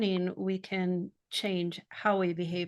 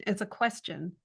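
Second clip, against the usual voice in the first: normal talking voice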